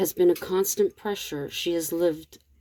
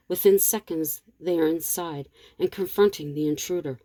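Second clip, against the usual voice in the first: plain reading voice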